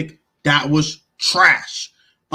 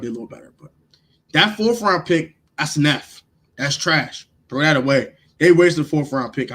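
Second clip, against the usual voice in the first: normal talking voice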